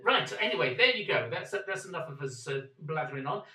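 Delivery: loud, punchy sign-off plug; rally viewers to subscribe; loud outro. Real, not acted.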